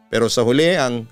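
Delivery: ordinary delivery, nothing marked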